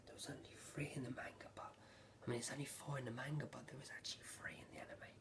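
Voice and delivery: hurried, very soft whisper; whispered aside. Real, not acted. Correcting self on manga versus anime, quick whispered aside. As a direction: whisper it quickly under your breath.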